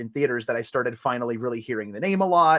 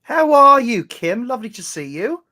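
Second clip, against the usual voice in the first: playful, higher-pitched British greeting; British accent, pitched up; put-on British accent; 9 semitones higher, 7 dB louder than usual. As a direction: greet them in a jolly mock-British lilt. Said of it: "I love doing the voices with you".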